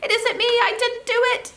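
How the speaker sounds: very high, loud, squeaky pleading little creature; frightened gnome. Acted. Captured gnome begging the prince to let it go, squeaky panic. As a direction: beg for your life in a squeaky panic.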